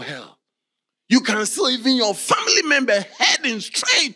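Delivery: high, loud challenging cry; fervent preaching. Real, not acted. Preacher challenging his congregation to save their families. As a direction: press the question hard on the church.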